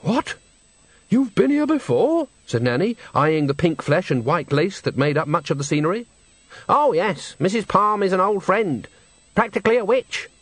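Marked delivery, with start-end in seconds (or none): 0.0-2.3 much higher astonished question; shocked. Acted. Nanny learning Granny has visited before, high shocked question. 6.7-10.3 higher, breezy matter-of-fact witch; lightly voiced old witch. Granny explaining her friend, light breezy tone.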